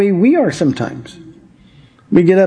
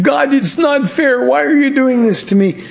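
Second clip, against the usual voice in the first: mock complaint; 9 semitones higher than usual. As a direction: plead "unfair" in a much higher mock voice. Voicing immature Christians complaining to God.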